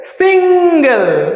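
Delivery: সিঙ্গেল stretched slowly, সিং-গেল; syllable by syllable. Shown as a demonstration. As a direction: draw out সিঙ্গেল in two syllables. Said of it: "দুটো syllable". (দুই ভাগে টেনে সিঙ্গেল)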